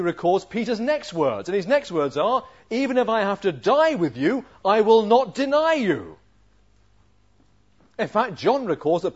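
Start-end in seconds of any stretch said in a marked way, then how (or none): 2.7-6.2 loud, high, impassioned declaration; preacher acting a fervent pledge; vehement vow. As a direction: swear it forcefully to the hilt. Peter's vehement vow acted out.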